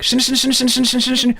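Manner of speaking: 死ぬ rattled off very fast and high; really fast. Shown as a demonstration. Showing viewers the fast part, 死ぬ rattled off fast. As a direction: rattle 死ぬ off as fast as you can. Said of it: "this part really fast too".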